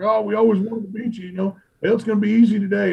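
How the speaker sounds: high, cocky mock taunt; taunting rivals. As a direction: play the smug rivals teasing. Imitating rival cooks taunting him.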